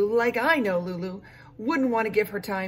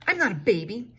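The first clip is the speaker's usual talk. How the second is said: indignant protest; 2 semitones higher, 5 dB louder than usual. Acted. Child protesting babysitter.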